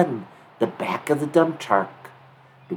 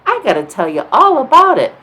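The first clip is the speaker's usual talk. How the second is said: high, loud excited voice; bursting to tell friends the adventure; thrilled kid; 13 semitones higher, 13 dB louder than usual. A narrator's reading. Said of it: "Dinky's like".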